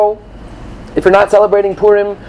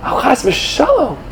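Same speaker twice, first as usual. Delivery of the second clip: slow, higher, hushed scandalized sigh; mock horror; 7 semitones higher, 3 dB quieter, slower than usual. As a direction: gasp it like a shocked gossip. Mocking a fretful student.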